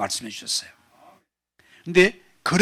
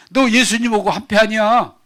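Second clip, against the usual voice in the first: accusing question; 6 semitones higher, 6 dB louder than usual. Acted. Girl accusing Peter.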